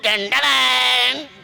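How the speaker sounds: a drawn-out high vroom of a scooter; engine revving noise. Retold or imitated. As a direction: rev it like a bike engine slowly. Revving the bike. (ધીમો, ઊંચો વાહનનો અવાજ)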